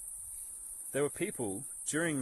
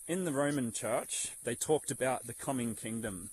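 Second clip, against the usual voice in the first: ordinary talk, nothing marked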